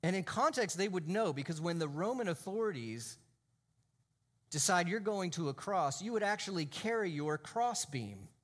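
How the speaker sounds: ordinary talk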